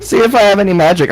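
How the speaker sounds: a tiny German figurine begging to be checked for magic; German-accented doll. Pretend. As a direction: squeak out the doll's plea in a German accent. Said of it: "I don't know why he's German".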